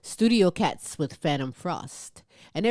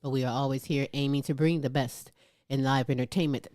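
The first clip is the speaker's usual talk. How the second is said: ordinary talk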